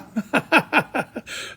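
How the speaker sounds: a real, high burst of laughter; hearty laugh. Real, not acted. Laughs at his own joke.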